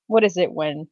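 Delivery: ordinary talk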